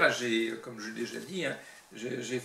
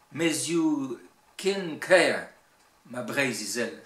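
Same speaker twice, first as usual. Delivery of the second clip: Breton verse with strong Cornouaille stresses; emphatic Cornouaille Breton recitation; Cornouaille Breton accent; 7 dB louder, much slower than usual. Shown as a demonstration. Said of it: "l'accent cornouaillais".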